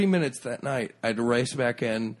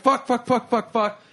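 high, loud burst of panicked swears; panicked swearing; 11 semitones higher, 3 dB louder than usual. Retold or imitated. Cursing at the locked computer, high and frantic. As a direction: rattle them off in a frantic panic. Panicking over the text code.

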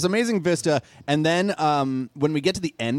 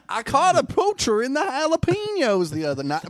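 put-on Western accent; 7 semitones higher than usual. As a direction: boast in a frontier accent. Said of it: "what accent is this".

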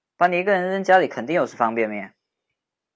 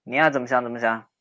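put-on playful bantering young man walking her home; teasing boyfriend-type friend. Teasing friend walking upset girl home, playful banter. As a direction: tease her, then soften and comfort.

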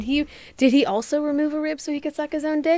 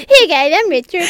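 high, loud Cartman impression; Richard; 6 semitones higher, 9 dB louder than usual. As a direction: introduce yourself in a cartoon Cartman voice. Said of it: "I always give Richard a Cartman voice".

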